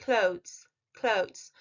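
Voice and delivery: "Clothes" said slowly with the th and a buzzing z at the end; "Clothes" pronounced as klohðz, repeated twice; careful pronunciation drill. Shown as a demonstration. (تلفظ شمرده "Clothes" با صدای ز در انتها)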